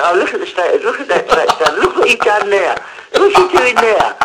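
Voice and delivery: a hint of mocking disbelief from above; amused God looking down. God up there watching the world, lightly voiced amused disbelief. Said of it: "he sits up there laughing".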